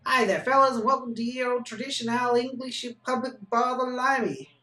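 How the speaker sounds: English accent attempt. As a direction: greet the punters in a hammed-up English accent. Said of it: "I can't do an English accent".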